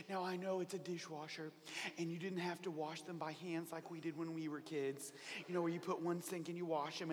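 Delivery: a mother gently coaxing help with dishes; sweet mom. Acted. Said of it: "your mom says".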